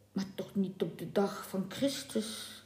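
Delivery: whispered; whispered objection; under his breath. A narrator's reading. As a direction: mutter it so the captain can't hear. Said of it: "zei de stuurman binnensmonds".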